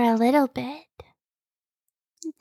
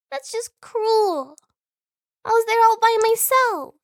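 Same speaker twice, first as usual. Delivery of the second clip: high, drawn-out, louder whine; mock-hurt pout; 10 semitones higher, 4 dB louder than usual. Sibling complains of being left in back, mock-hurt pout. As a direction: pout as if badly wronged.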